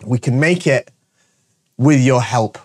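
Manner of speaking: ordinary delivery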